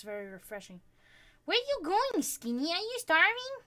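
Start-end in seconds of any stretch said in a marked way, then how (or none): 1.4-3.5 pet talk. Real, not acted. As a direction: coo up high at a hungry little dog. Talking to hungry pet.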